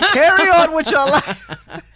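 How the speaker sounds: slow, high laughing dismissal. Real, not acted. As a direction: wave it off with a laugh.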